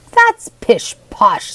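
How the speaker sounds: very slow, loud gruff scoff. Acted. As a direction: drag out the scorn. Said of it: "said M-, Mister Toomey".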